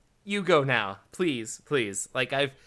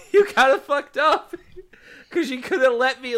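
laughing; 6 semitones higher, 4 dB louder than usual. Real, not acted. Laughing at partner's pun.

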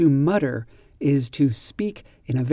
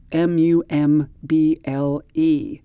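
usual speaking voice

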